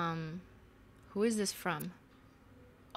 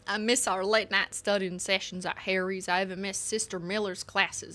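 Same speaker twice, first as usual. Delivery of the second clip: letter read with a Texan twang; Texan accent. Acted. Friend writing to Anne about the holidays, Texan accent. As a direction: keep reading like a Texan.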